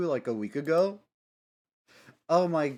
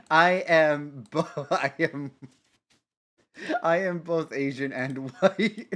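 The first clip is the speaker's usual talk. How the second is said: laughing; 3 semitones higher, 5 dB louder than usual. Retold or imitated. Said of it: "she says in Japanese with an, with a heavy American accent".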